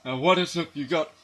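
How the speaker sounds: a bit hammed-up old man grabbing cereal; old husband voice. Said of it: "Mister O is like".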